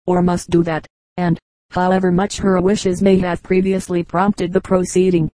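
plain reading voice

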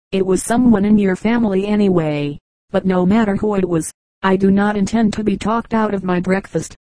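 ordinary delivery, nothing marked

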